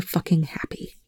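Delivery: voiceless, bitter whisper; whispered curse. A narrator's reading. Telford to Rush, cursing in a whisper. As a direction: spit the curse under your breath.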